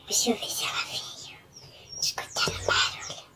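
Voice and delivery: soft secret whisper. Acted. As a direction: whisper so nobody hears. Pretending to sleep, listening for fairy.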